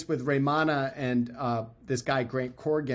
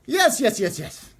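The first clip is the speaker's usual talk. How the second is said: much higher, louder repeated yes; eager yes; 10 semitones higher, 6 dB louder than usual. Retold or imitated. Raymana eagerly agreeing to tell the story, higher, louder.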